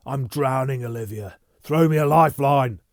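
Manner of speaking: loud high pleading; loud desperate plea to the woman. Acted. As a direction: beg her desperately.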